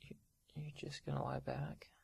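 hushed, scared whispering; nervous whisper. Acted. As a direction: whisper so nobody hears. Secretive fear of being caught.

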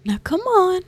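high, loud cheery coax. Acted. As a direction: urge them on brightly. Coaxing pet into bed.

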